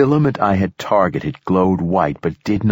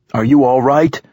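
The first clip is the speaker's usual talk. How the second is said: raised, higher urgent call; loud, high-pitched check on someone; shouting over rapids; 6 semitones higher, 5 dB louder than usual. A narrator's reading. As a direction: shout to be heard over rushing water. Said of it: "I shouted over the rapids".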